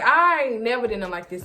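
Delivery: normal talking voice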